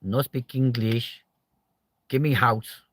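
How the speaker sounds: slow, lightly put-on foreign voice; a hint of a migrant's broken English, slowly; mock broken English. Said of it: "just be like".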